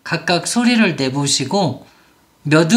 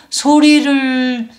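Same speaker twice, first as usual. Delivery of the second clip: 소리를 stretched long and higher; drawn-out emphasis; 7 semitones higher, 3 dB louder, much slower than usual. Shown as a demonstration. Teacher stressing which vowels count, drawn-out 소리를. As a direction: draw out 소리를 slowly, pitch lifted. Drawing out the key word.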